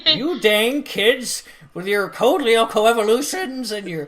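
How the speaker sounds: put-on cranky old man grumbling; grumpy grandpa scolding kids. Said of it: "I wish I had a better old man voice".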